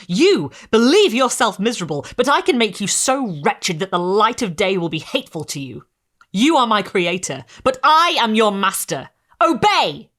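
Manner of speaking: loud, commanding creature rage; menacing threat. Acted. Creature threatening Victor, loud commanding fury. Monster threatens his maker.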